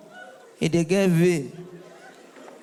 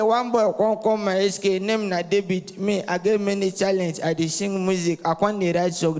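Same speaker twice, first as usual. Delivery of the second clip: ordinary talk, nothing marked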